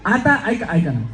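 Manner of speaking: normal talking voice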